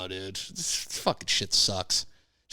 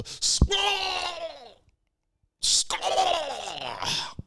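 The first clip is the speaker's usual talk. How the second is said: comic projectile-puke noise; imitated vomiting. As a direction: imitate throwing up, high and drawn out. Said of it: "I just go".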